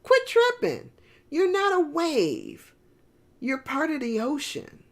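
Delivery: mocking, mostly high scolding; scolding wave. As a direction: tease a panicking friend back to sense. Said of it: "he's like".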